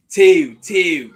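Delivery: loud, high Baltimore call of "Two, two"; Baltimore accent. Retold or imitated. Teammate mocks his college corner's Baltimore accent, loud and high. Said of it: "That's how they say it".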